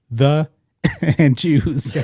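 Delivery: words dissolving into laughs; breaking into laughter. Real, not acted. Reader quoting the comedian's joke, laughing. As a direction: read it out, cracking up at the punchline.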